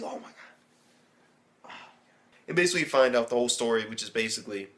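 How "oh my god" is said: slow breathless whisper; stunned whisper. Retold or imitated. Watching the villain kill everybody, awed slow whisper. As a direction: barely breathe it out in shock. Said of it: "I'm like".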